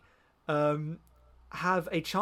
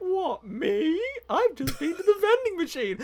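high mock-innocent child voice; feigned innocence; 14 semitones higher, 3 dB louder than usual. Orphan caught by mum, high hammed-up innocent denial. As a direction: play the wronged little kid, very high.

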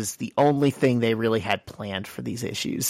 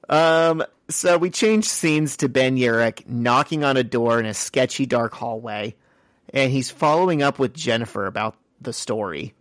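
ordinary delivery

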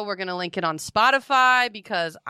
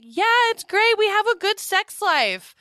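high loopy drugged voice; 12 semitones higher, 3 dB louder than usual. Retold or imitated. Drugged self retold.

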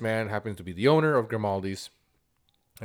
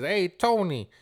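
high-pitched mock call across a shop; retold holler with an attempted New York accent; calling someone over; 9 semitones higher, 5 dB louder than usual. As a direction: imitate a boss summoning his worker. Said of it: "he calls this guy".

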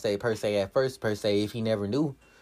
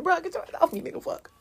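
mock brush-off. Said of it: "he was like".

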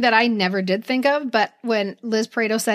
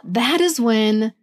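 slow, low, hushed suspense; ominous storyteller; 6 semitones lower, slower than usual. Real, not acted. Recapping the charm's return to the hotel, ominous. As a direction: draw it out toward a whisper, ominously.

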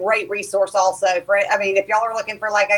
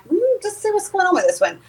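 mock curious inner voice; 11 semitones higher than usual. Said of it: "And then I get home and I'm like".

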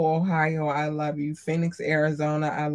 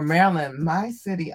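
usual speaking voice

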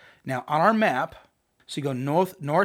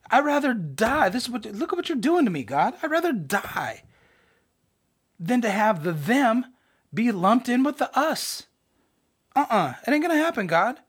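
high, indignant hammed-up refusal; drama tantrum; 8 semitones higher than usual. Jonah refusing to share God with the them, mock tantrum. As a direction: throw a mock fit at God, pitch raised. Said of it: "Jonah put on a full drama tantrum".

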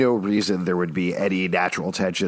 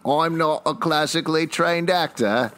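put-on a light, hammy stab at an Australian accent, pitched up; mock Australian accent; 7 semitones higher than usual. Teasing a cohost, mock Australian accent. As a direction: parrot the line back in a put-on Australian accent. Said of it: "let's hear your Australian accent".

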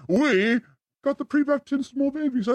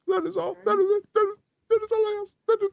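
rising mock plea; 9 semitones higher, faster than usual. Escalating plea for appreciation.

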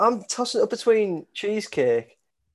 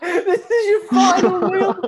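high, drawn-out words through laughter; laughing incredulously; 6 dB louder, slower than usual. Real, not acted. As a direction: crack up while protesting, voice shooting up. Cracking up at friend's order.

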